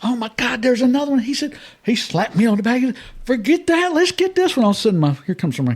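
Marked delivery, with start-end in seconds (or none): 0.0-1.2 high-pitched excited quote; acted-out burst of excitement; excited retelling. Retelling his excited sighting of fish. 3.2-4.6 very high excited brother impression; acted-out urging, pitched way up; urgent egging-on. Brother urging him toward new fish.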